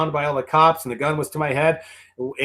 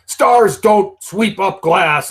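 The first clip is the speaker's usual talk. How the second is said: slow, higher-pitched mock scolding; imitated telling-off; 5 semitones higher, 4 dB louder, much slower than usual. Boss chewing out his star over sweeping glass, mock scolding. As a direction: draw out an indignant boss's reprimand, mockingly. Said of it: "tore into me like nobody's business".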